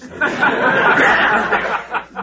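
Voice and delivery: genuinely amused laughter; loud laugh. Real, not acted. Guest laughs at host's joke.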